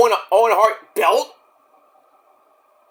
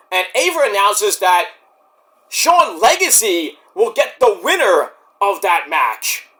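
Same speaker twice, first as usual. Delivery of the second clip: ordinary talk, nothing marked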